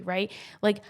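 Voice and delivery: normal talking voice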